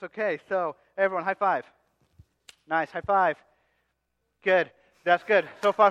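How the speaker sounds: normal talking voice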